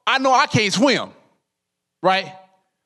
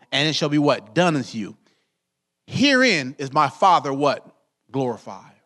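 scripture reading; 5 semitones lower than usual. Acted. Pastor reading verse six, low and measured. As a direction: read it solemnly, asking the church to fill in.

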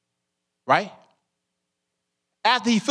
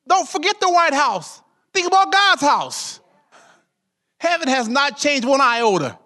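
higher, driving preacher cadence; preaching; 6 semitones higher than usual. Acted. Pastor urging the saints not to worry, preaching high. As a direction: preach it out, lifting the church.

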